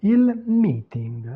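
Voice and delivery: "meeting" said slowly the Italian way; Italian pronunciation. Shown as a demonstration. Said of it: "La prononciation des mots anglais est différente en italien".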